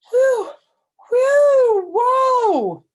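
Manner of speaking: high, loud panting gasps; out of breath. Acted. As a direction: puff each one out, still winded. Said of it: "tried to catch my breath".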